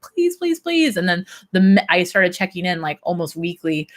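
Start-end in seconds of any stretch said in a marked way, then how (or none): 0.0-1.0 high, begging please; pleading. Retold or imitated. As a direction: beg like it's desperate. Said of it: "And I was like".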